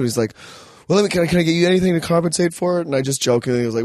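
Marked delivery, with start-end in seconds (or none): 0.9-2.9 fast, eager, apologetic; rushed eager offer. Retold or imitated. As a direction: rush the offer out. Motel guy quoted.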